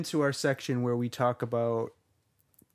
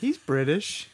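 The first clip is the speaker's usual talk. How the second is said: mock British accent; 3 semitones higher than usual. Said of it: "I sound like a British worm".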